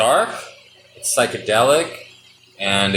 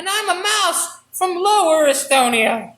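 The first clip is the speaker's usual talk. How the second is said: higher mouse voice; 12 semitones higher than usual. Acted. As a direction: chirp out the introduction. Mouse introduces herself in skit.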